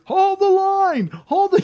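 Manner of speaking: hammed-up movie war cry; quoting a film commander, stretched out slow; Gladiator battle cry. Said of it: "the scene from Gladiator".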